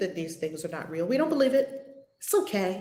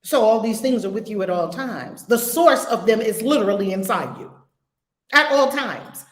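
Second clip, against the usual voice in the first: usual speaking voice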